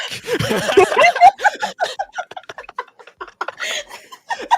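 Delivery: high burst of real laughter; big laugh. Real, not acted. Laughing at the promo line, high cackling laugh. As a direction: crack up hard.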